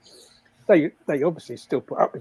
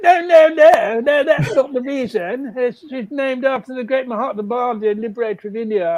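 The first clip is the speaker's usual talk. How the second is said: higher, put-on voice of a woman setting the record straight; indignant wife; 7 semitones higher, 5 dB louder than usual. Wife corrects the workman about the dog's name, pitched-up indignant voice. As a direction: correct him firmly, pitched up.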